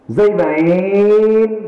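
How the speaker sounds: put-on Mymensingh accent, যাইবাইন stretched long and high; Mymensingh drawl. Preacher mimicking Mymensingh folk, slow drawn-out Mymensingh accent. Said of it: "এরকম টান মারে".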